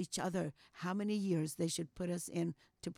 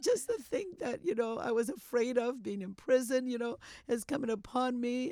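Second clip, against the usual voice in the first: fearful cry; 5 semitones higher, 3 dB louder than usual. Retold or imitated. Wife fears prison, tells husband.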